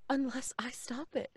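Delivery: unvoiced whisper; whispered resolve. Kay whispering her grim plan to Florence. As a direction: breathe it like a dark secret.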